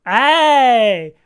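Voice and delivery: loud, high victory cry; triumphant shout. Real, not acted. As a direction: cheer the win. Guessed the song correctly.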